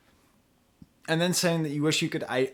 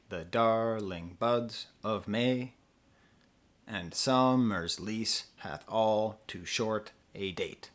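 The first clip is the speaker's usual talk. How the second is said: slow, measured verse, "compare" and "summer's" broken into beats; iambic scansion; 4 semitones lower, 4 dB quieter, much slower than usual. Shown as a demonstration. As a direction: recite lower and evenly, leaning on every second syllable as in "com-PARE". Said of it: "and goes short, long".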